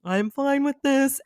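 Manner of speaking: high, slow, strained "I'm okay"; unconvincingly fine, loud and high; mock self-reassurance. Acts out talking herself into eating it.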